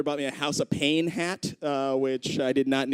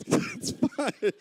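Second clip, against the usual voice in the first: ordinary talk, nothing marked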